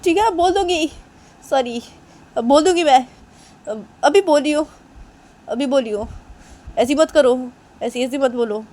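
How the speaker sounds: acted insistent concession; exasperated giving in. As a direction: give in under protest. Giving in during acted quarrel.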